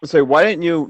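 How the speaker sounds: normal talking voice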